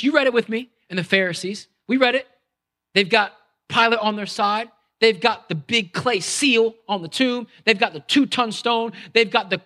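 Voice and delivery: ordinary delivery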